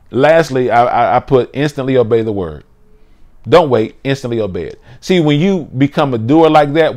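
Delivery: fired-up preacher calling out points; preaching. Acted. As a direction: drive each point home to the congregation.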